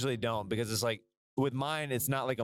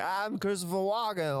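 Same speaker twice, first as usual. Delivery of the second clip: Christopher Walken impression; 8 semitones higher than usual. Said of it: "the guy who does the impressions is like".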